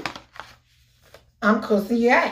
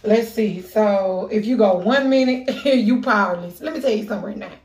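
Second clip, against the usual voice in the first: ordinary talk, nothing marked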